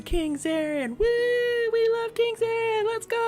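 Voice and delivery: cheering crowd. Acted. Crowd greeting King Zarin, high-pitched cheering. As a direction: squeal like a crowd of fans. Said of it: "And everyone's like".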